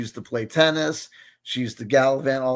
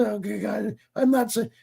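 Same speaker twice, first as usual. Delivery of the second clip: imitating mom; 5 semitones higher than usual. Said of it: "she'll say".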